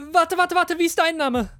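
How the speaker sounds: high, loud, rapid-fire repetition; urgent call. A narrator's reading. Prisoner calling back the departing guard, urgent. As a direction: stop someone before they leave.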